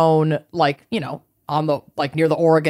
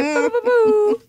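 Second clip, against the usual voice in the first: mock sobbing. Mock crying over birthday.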